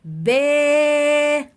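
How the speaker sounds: demonstrating the syllable "با" slowly and clearly; drawn-out ba. Shown as a demonstration. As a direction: sing out "با" as one long open vowel. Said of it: "هقول إيه؟".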